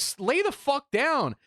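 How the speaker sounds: high-pitched mock yell; imitated exasperated order. Family in front seats to injured man, high exasperated mock yell.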